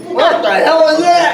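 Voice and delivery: laughing what-the-hell; incredulous outburst. Retold or imitated. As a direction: blurt it out through a laugh. Reacting to the salad casserole.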